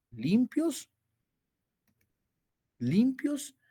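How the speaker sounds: high emphatic. Shown as a demonstration. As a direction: lift "limpios" high and ringing. Repite la palabra clave alto. ("limpios" muy agudo y sostenido)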